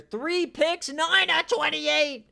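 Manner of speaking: high, loud stat call; loud, high mocking outcry; incredulous shout. Real, not acted. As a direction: shout the bad numbers in disbelief. Quarterback's bad stat line.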